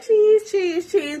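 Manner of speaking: grin through it; mock forced cheer. Zanique forcing smiles for pictures, mock cheer. Imitating Zanique posing for photos.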